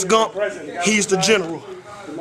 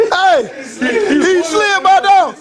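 mock outrage; 8 semitones higher, 3 dB louder, much slower than usual. Real, not acted. Mock outrage after slide.

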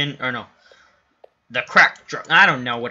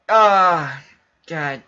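ordinary delivery

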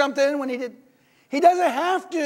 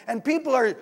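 usual speaking voice

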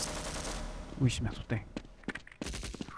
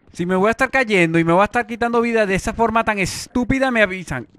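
higher, louder exasperated complaint; frustrated yell; 8 semitones higher, 8 dB louder than usual. Real, not acted. Gamer yelling at the viewers about dying while jumping. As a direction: vent at the stupid falls. Falling repeatedly in parkour game.

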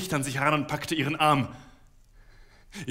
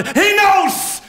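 much higher, much louder yell; furious shout; 13 dB louder than usual. A narrator's reading. Husband screaming at his wife to leave, furious shout. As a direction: scream it once more. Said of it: "schrie noch einmal".

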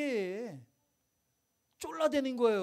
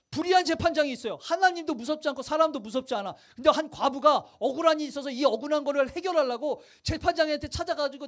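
ordinary delivery

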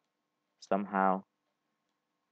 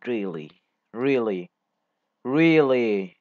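building exasperation; 4 dB louder, slower than usual. Real, not acted. Lag strikes again.

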